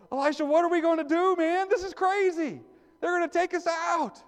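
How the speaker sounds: high, loud hammed-up panic; mock panic. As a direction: play the terrified servant, shrieking it way up high. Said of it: "the servant's starting to really sweat".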